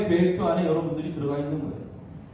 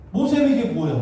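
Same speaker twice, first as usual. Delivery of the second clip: exasperated protest; 6 semitones higher, 5 dB louder than usual. Acted. Voicing Moses' own bewildered protest.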